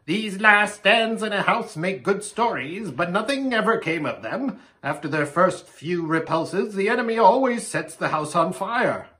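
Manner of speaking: high raven character voice; croaking raven. A narrator's reading. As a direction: speak as a raven giving counsel. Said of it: "croaked the raven".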